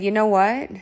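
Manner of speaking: usual speaking voice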